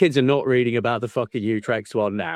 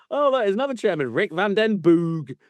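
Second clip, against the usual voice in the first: mock introduction; 7 semitones higher than usual. Mimicking the meeting of another chairman, hammed-up introduction. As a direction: rush in high, then stretch out the name.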